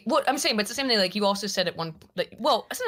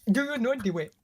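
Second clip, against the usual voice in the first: put-on African accent; 3 semitones higher than usual. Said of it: "Your African accent's better than fucking mine".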